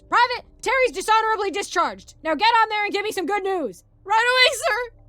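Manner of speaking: high, loud barked exchange; military yelling. Acted. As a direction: shout it like soldiers under orders. Sergeant yelling orders, private answers.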